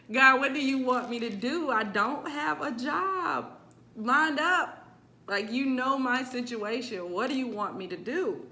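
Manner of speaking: begging God for an answer, close to tears; retold crying prayer. Woman in her car after losing her job, praying in a tearful, pleading voice. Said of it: "I cried so hard".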